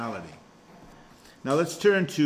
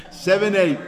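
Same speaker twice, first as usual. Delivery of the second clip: much louder, higher, playful call-out; jokey, raised-voice repeat; mock forceful announcement; 6 semitones higher, 7 dB louder than usual. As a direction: belt out the verse number for laughs. Repeats the loud joke amid laughter.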